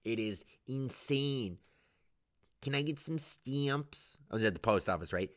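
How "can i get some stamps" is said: put-on nasal-style Detroit accent, slow; Metro Detroit accent. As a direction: imitate a Metro Detroit customer. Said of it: "how Midwestern people in Metro Detroit sound".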